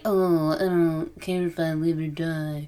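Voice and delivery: slow, affected too-cool voice; mock cool and sexy. Cohost mocking people who glamorize the game, affected cool drawl. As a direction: drawl it out, mocking would-be badasses. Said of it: "sound so cool and sexy".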